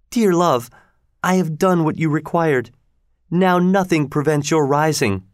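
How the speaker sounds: high-pitched, coaxing queen; devoted sorceress. A narrator's reading. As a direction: coax a beloved to rise, voice high. Voices the sorceress to her beloved.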